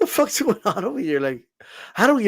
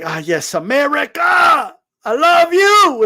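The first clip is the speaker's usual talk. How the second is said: mock gushing patriot; 7 semitones higher, slower than usual. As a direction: gush over the country like a lovesick fan. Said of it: "they come on YouTube and they're like".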